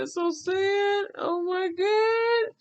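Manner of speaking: high, slow sobbing words; crying. Retold or imitated. Grieving the widow's loss, sobbing out her words. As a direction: break down in tears as you say it. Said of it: "And I broke down crying".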